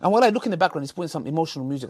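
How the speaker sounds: ordinary talk, nothing marked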